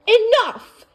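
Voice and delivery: loud, high, sharp outcry; queen losing control, crying out high; cried out. Acted. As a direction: cut everything off with one loud word. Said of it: "Elsa cried".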